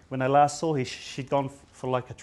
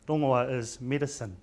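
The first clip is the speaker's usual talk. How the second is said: ordinary talk, nothing marked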